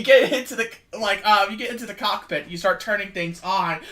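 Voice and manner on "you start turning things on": ordinary delivery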